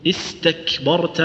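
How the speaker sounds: slow, corrected "استكبرت" with ك held before its breath; in "استكبرت", close firmly on ك and ت, then release; segmented teaching take of "استكبرت"; stop before the breath. Shown as a demonstration. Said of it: "على طول، لا،".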